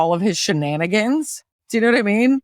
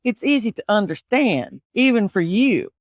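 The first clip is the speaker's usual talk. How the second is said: put-on Southern accent. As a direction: play a needling Southerner with a thick accent. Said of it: "can you do an Appalachian accent".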